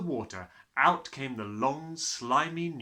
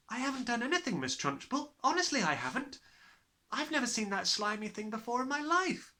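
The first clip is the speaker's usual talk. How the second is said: put-on pleading child; 12 semitones higher than usual. A narrator's reading. Matilda protests innocence.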